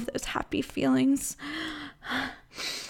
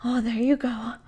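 normal talking voice

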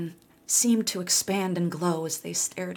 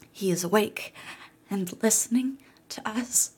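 whispered aside; 4 semitones higher than usual. Acted. Jessica hushes about listening boy.

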